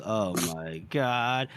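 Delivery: low, slow, dragged-out groan of words; reluctant. Acted. Boy following the two thugs' lead, reluctant. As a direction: go along with it very unwillingly. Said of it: "he's reluctantly following their lead".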